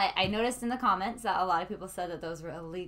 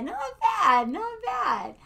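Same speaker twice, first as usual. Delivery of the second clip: very high, singsong teasing praise; playful approval; 11 semitones higher, 3 dB louder, much slower than usual. Pretend. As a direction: pitch it way up, drawn out.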